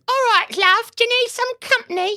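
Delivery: very high, loud hammed-up come-on; put-on high voice, calling to a customer; Victorian streetwalker. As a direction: squawk it like a Victorian streetwalker touting for trade. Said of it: "see all these, like, Victorian things".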